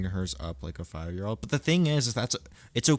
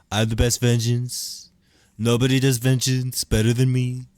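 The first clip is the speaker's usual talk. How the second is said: loud mock villain brag; louder, higher braggart; big bragging villain; hammed-up boast; 5 semitones higher, 9 dB louder than usual.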